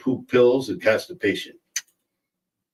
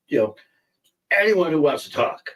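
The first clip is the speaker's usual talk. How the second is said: normal talking voice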